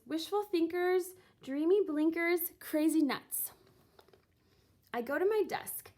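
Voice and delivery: ordinary delivery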